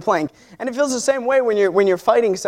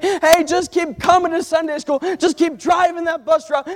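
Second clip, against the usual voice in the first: preaching at full force; 6 semitones higher than usual. Acted. Pastor urging the church to encourage one another, fervent. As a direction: preach it to the congregation with fire.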